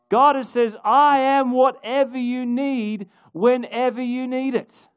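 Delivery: rising, ringing sermon cadence, each line hammered home; preaching at full force. Acted. Pastor to his congregation, voicing God's promises, preaching at full force. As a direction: proclaim it like a pastor in full flight.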